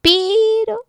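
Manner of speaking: sudden high squeak; surprised squeal. Acted. Tree's sudden idea.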